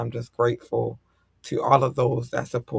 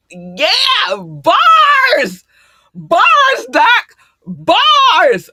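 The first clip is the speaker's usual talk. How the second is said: high full-throated hype shout; going off; 13 dB louder, much slower than usual. Real, not acted. Host hyping Doc's words, going off in loud shouts. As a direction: yell it like a wild hype man. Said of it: "I went off".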